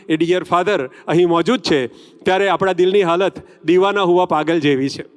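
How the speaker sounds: usual speaking voice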